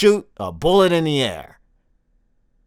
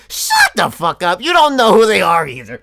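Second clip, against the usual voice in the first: exasperated shout; 3 semitones higher, 5 dB louder than usual. Acted. Comedian silences fans pretending to love local bands, exasperated yell. Mock outburst at fake band supporters.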